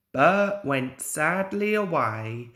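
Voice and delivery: plain reading voice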